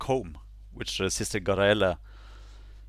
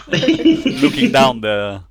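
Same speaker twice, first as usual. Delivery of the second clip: laughing; 3 semitones higher, 11 dB louder, slower than usual. Real, not acted. GM laughing at the players.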